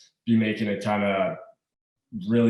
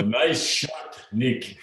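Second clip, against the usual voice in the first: a hint of a Russian accent, laughing; 2 semitones higher, much slower than usual. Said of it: "In your best Russian accent".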